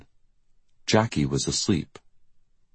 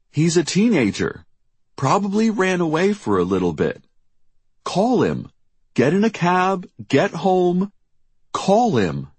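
high-pitched, brisk commands; urging; 4 dB louder than usual. A narrator's reading. Jackie telling worried mother to go home, urging. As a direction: push her to act, pitch well up.

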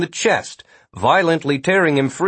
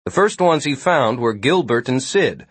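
ordinary delivery, nothing marked

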